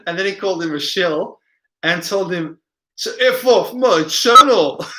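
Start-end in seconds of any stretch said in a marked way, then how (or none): 3.2-4.8 Yorkshire impression drifting into Scots, pitched up; slow, higher mock Yorkshire send-off; mock Yorkshire accent. Streamer imitating a video maker dismissing someone, mock Yorkshire accent. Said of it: "your Yorkshire's more Scots".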